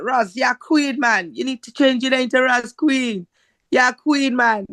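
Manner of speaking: put-on Caribbean accent. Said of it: "a strong Caribbean accent".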